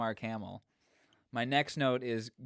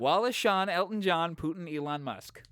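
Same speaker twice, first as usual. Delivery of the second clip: high, punchy rhythmic list of names; playful rhyme recital; 6 semitones higher than usual. Pretend. Performing a made-up rhyme, repeated.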